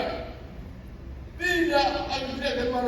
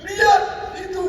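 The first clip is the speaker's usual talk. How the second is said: shout; 6 semitones higher, 6 dB louder than usual. Acted. Grandfather points out the flags.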